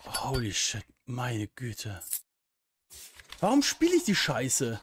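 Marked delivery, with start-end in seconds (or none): 3.4-4.8 exasperated. Real, not acted. Frustrated after scares.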